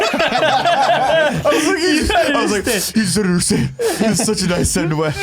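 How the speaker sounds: laughing through the words. Real, not acted. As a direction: giggle while you talk. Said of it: "Why you're sobbing?".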